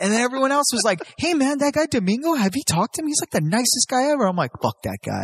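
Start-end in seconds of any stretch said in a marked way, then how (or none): 1.2-4.2 high, eager imitation of gushing friends. Friends praising Domingo to the speaker, in a high gushing mock voice. 4.6-5.2 low, softer mock grumble; grudging dismissal. Telling friends off about Domingo, low grudging grumble.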